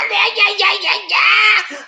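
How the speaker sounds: very high cackling "yeah"s; laughing whoop. Pretend. Jokingly calls on Perry Mason.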